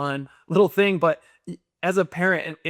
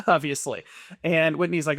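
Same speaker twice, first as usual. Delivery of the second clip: normal talking voice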